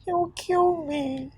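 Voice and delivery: slow, soft, fearful words; scared wife admitting the danger; frightened hush. Acted. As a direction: barely dare to say it. Frightened woman confesses threat.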